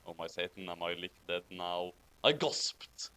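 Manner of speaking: ordinary talk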